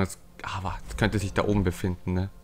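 unvoiced, hushed aside; whispering. Real, not acted. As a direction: whisper it to yourself. Aside while exploring. (flüsternd)

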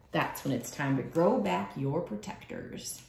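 plain reading voice

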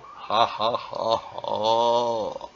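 loud, slow mock villain laugh; big drawn-out "ha ha ha ha", gloating; hammed-up triumphant cackle; villainous laugh. Gloats as most dangerous warrior.

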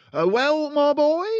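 booming high, loud bragging dad voice; loud boastful father. Acted. Father boasting to his son, booming and loud. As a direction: crow it out like a smug car dealer. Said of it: "addressed his son in a loud voice".

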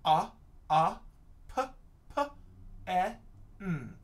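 'A, a, p, p, e, n' as short isolated letter sounds; sounding out. Shown as a demonstration. Teacher decoding a sight word with pupils, sounding out. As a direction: sound out 'A, a, p, p, e, n' with a gap after each. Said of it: "sound it out".

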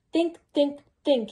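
ordinary delivery

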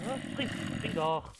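loud, urgent urging; being chased. Real, not acted. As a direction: yell at yourself to jump. Urging jump while chased.

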